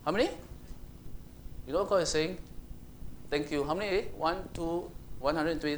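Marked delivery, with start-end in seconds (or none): none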